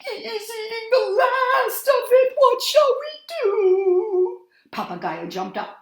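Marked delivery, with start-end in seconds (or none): 0.1-4.5 high, loud, crying creature voices; panicked wail. A narrator's reading. Night creatures crying as the dog eats the moon, high and loud. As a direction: wail in panic, much higher and louder. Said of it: "the night creatures moaned".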